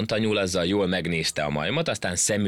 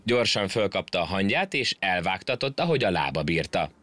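ordinary delivery, nothing marked

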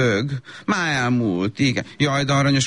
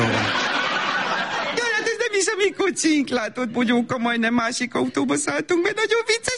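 high giggling chatter to cover embarrassment; embarrassed mother's high laughing coo; giggly baby talk; 9 semitones higher than usual. Acted. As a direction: laugh it off sweetly, pitched up.

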